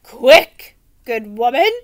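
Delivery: loud urgent knight call. Acted. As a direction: urge her on, loud. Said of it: "Sir Fartslot urged".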